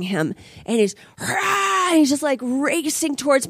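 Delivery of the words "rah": high imitated dog roar; attacking dog roar. Pit bull racing at her son, mimicked roar.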